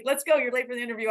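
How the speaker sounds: high, quick "let's go" summons; mock impatient hurrying. Reporter showing what she didn't mean, impatient hurrying. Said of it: "I'm not like".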